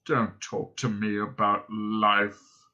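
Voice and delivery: low, slow, miserable drone; depressed robot. A narrator's reading. Robot moaning to his companions, droning depressedly. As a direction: drone on in bottomless self-pity. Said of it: "I'm so depressed".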